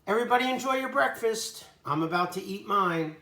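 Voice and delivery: cheery sign-off. Real, not acted. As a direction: wrap it up with upbeat energy.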